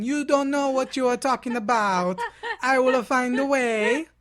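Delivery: loud, hammed-up Italian accent, slowing down; mock Italian accent. Columbus shouting at the doubters in mock Italian accent. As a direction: play a boastful Italian sailor, loudly. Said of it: "He's Italian".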